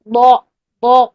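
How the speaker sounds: بو as a long heavy ضا, rounded "aw"; tongue sides up, hold بو full and back; heavy ضاد with fatha. Shown as a demonstration. Teacher models the right sound, heavy ضا. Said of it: "یہ چیز بنے گی".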